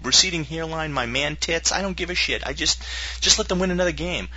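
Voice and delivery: high, rambling bargain; pleading fan. Retold or imitated. Fan praying to win another game, pleading. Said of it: "sat there every night and said".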